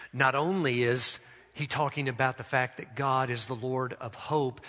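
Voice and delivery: ordinary talk, nothing marked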